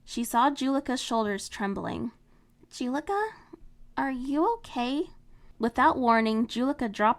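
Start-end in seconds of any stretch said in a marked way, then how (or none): 2.7-5.1 higher, gentle asking; worried friend, gentle; concerned friend. Acted. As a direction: check on her gently. Marinette concerned.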